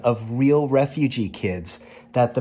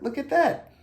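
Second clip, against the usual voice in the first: sudden high squeal to show it off; excited; 14 semitones higher than usual. Real, not acted. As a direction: point and pop up much higher. Showing off shirt to kids.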